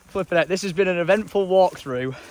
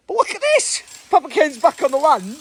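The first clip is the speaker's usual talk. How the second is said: much higher, bright delighted welcome; overjoyed to see him again; excited greeting; 11 semitones higher than usual. Real, not acted. Greeting Papa Ken back on the land, high and thrilled.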